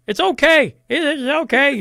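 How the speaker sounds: high-pitched parody of a health messenger; mocking official reassurance. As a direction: chirp the slogans in mock reassurance.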